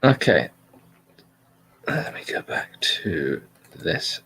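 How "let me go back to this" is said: slow, whispered aside to himself; muttered aside. Real, not acted. Host whispering to himself while finding his place, slow and hushed. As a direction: drop to a near-silent whisper, slowly.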